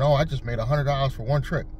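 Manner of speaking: usual speaking voice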